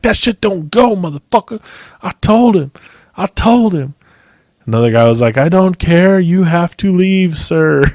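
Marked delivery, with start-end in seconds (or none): 0.0-3.9 high-pitched feisty mock outrage; put-on high, indignant ranting; worked-up impression of old man. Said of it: "he was so fucking G'd up". 5.4-7.8 high-pitched, drawn-out insistence; put-on official firmness, pitched up; stern worker. Worker ordering customer out.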